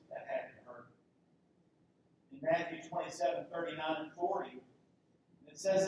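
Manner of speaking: ordinary delivery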